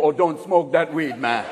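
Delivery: a lightly put-on Jamaican accent, unhurried. Jamaicans warning about California weed, light Jamaican accent. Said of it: "even Jamaicans go".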